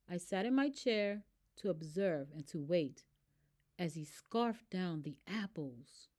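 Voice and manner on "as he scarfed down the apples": plain reading voice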